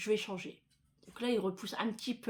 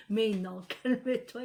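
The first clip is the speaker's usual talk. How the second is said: laughing calme-toi; 5 dB louder, slower than usual. Retold or imitated. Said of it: "il me faisait".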